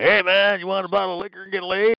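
high, quick pitch of a shady recruiter; mock recruiter voice. Recruiter offering the bum a bottle, in a hyped pitch. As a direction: sell it fast and high like a hustler.

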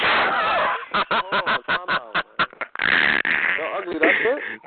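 long pulsing cackle; mocking laugh. Real, not acted. Streamer after cursing the rival, long cackle. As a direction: burst into a drawn-out cackle.

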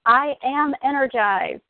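slow, rising affirmation to lead the group. Shown as a demonstration. Leader of a phone call group calling out an affirmation, slow. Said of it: "caretakers and training call".

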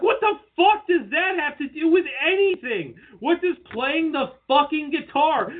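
high-pitched incredulous ranting; exasperated rant. Retold or imitated. Wrestling fan answering Vince's guitar argument, exasperated.

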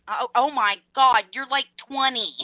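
ordinary talk, nothing marked

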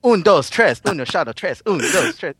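playful count-in. Pretend. Playful Spanish count-in before take.

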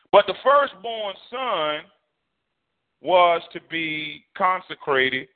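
drawn-out preacher cadence; slow down and lean on each word; measured sermon delivery; slow preaching emphasis. Real, not acted.

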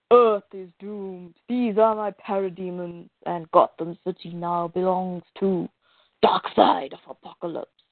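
deep-pitched comic-book tyrant; villain's proclamation. Acted. Storyteller voices villain Darkseid announcing conquest.

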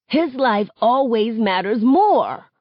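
gruff and tight-throated, slow. Acted. Arguing with a friend, gruff and choked. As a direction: push it out rough through a tight throat. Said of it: "My voice is gruff".

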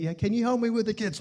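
quick, light sweet-wife voice. Retold or imitated. Husband imitating his sweet wife asking, quick. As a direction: ask nicely, hurried. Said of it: "she's real sweet".

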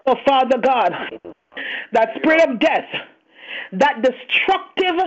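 loud, forceful preaching prayer; shouting faith healer. Real, not acted. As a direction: cry it out with full force. Climax of deliverance prayer.

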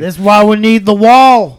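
loud, drawn-out slogan; mock political outburst. Chiming in as the Trump-supporter neighbor, mock ranting. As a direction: belt it out slowly as a ranting neighbor.

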